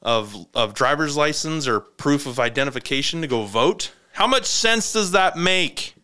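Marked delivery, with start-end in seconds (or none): 4.1-5.9 incredulous. Real, not acted. Ranting at government.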